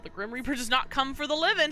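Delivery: ordinary talk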